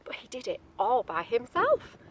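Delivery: usual speaking voice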